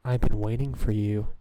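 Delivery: loud, low monotone robot; robot voice imitation. Streamer parrots the game's self-voicing, loud robot monotone. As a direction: boom it out like a text-to-speech machine.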